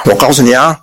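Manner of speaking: ordinary delivery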